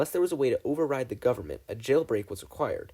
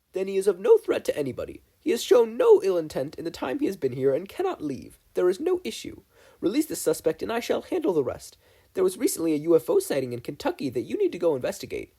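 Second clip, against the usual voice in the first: higher and louder, bossy; commanding official; 7 semitones higher, 4 dB louder than usual. A narrator's reading. Mabel ordering agents to release him, higher. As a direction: give orders like a congresswoman.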